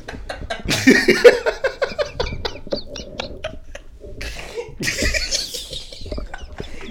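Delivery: long silent wheeze rising high; breathless laughter, no voice; real long laughing fit; long wheezing laugh. Real, not acted.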